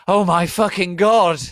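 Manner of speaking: loud, high shriek of dismay; horrified cry. Acted. Ebony seeing her secret videos, loud horrified shriek. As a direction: shriek in mock horror. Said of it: "I cried".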